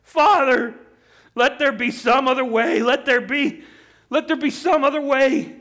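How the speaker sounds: anguished, high-pitched cry of prayer. Acted. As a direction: cry out to a father, begging for some other way. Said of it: "It's Jesus crying out".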